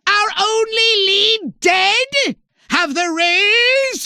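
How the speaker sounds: slow, high, loud incredulous shout; outraged disbelief. Acted. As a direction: shriek it as the plan collapses. Commander shocked their lead died.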